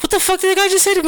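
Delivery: fast, high-pitched incredulous question; imitating a shocked bartender; retold outraged disbelief.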